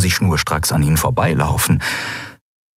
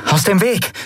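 demanding; 12 semitones higher than usual. A narrator's reading. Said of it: "forderte Endriel".

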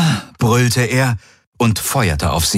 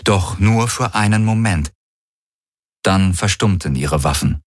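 plain reading voice

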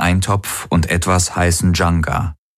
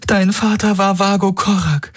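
very high, strained plea; tearful; 14 semitones higher than usual. A narrator's reading. Woman in tears reminding her friend of his father, tearful. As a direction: push the words out high, through tears. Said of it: "Sie fühlte Tränen über ihre Wangen rinnen".

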